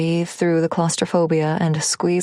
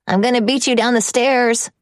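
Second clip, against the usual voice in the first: put-on playful child; 6 semitones higher, 3 dB louder than usual. Boy challenging mom to race.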